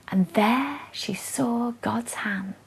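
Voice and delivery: whispered slowly, in awe; awed whisper at the sight. Acted. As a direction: hush it, slow and reverent. Dramatic hush in poem.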